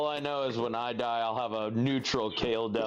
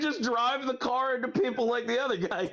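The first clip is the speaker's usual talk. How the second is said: laughing; 10 semitones higher than usual. Real, not acted. Joking about the bomber.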